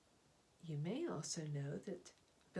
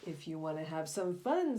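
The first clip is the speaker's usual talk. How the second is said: a lightly voiced tempting shark, louder; sly shark; 9 dB louder than usual. Shark tempting the serpent, lightly voiced sly offer. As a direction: coax him like a shark with a plan. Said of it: "said a shark".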